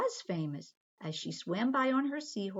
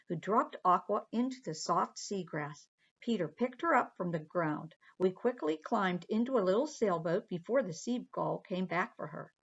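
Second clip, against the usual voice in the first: ordinary delivery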